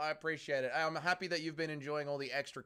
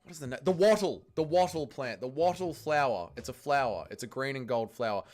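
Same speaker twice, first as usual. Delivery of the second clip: ordinary talk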